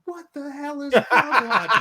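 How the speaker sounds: high, loud incredulous yell; imitated scream of disbelief; screaming security guard. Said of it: "Security screaming".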